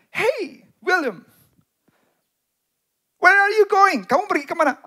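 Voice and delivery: high, loud call across the rain. Retold or imitated. Mother calling to a boy walking in the rain, loud and high. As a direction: call out to him from the car.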